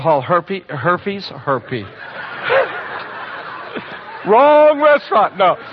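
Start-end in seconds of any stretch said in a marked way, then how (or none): 1.8-5.4 laughing. Real, not acted.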